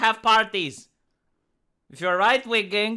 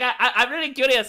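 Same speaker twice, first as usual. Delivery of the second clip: ordinary talk, nothing marked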